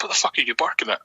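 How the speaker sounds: usual speaking voice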